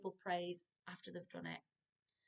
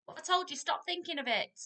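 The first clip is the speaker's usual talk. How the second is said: put-on louder, higher scolding of a child; scolding parent; 8 semitones higher, 6 dB louder, faster than usual. Shown as a demonstration. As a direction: tell the child off sharply.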